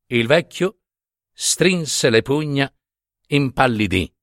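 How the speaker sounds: usual speaking voice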